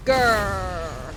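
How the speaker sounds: loud rage growl; frustrated gamer growl; angry growl. Real, not acted. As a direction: growl it out furiously. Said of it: "So angry".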